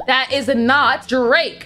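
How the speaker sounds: ordinary delivery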